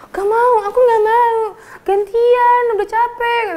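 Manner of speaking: put-on high, crying child's whine; whiny kid. Kid on the swing whining to her friend, crying. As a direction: whimper like a stubborn little kid.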